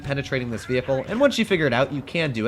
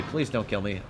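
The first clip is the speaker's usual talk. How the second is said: softer, pleading under breath; quiet pleading; 5 semitones lower, 6 dB quieter than usual. Real, not acted. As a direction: beg quietly, almost to yourself. Repeated plea, quieter.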